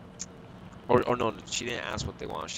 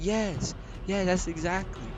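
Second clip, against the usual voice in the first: much higher, imitating a complaining lady; mock whiny customer; 9 semitones higher, slower than usual. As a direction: parody her indignant protest in a high voice. Mocking customer agreeing eagerly.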